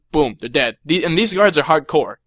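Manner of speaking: ordinary talk, nothing marked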